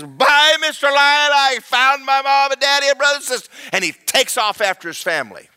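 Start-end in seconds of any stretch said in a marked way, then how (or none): 0.0-3.5 high, loud, quick little creature; excited shout tumbling out fast; gleeful farewell. Acted. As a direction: call out happily while flying away. Creature joyfully flies off to family.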